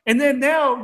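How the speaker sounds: ordinary delivery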